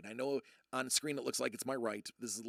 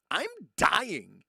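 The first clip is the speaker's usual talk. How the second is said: higher, louder mock panic; hammed-up alarm, raised and loud; 5 semitones higher, 10 dB louder than usual. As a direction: blurt it like you think you're dying. Panicked inner reaction.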